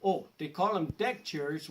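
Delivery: ordinary talk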